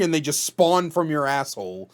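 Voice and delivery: ordinary talk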